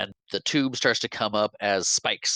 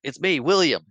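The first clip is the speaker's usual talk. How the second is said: raised voice for Charlie; imitating Charlie; 7 semitones higher, 5 dB louder than usual. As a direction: plead to be recognized. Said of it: "he's like".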